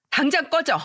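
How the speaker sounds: angry shout. Retold or imitated. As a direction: yell him out the door. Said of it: "화를 내면서 소리쳤어요".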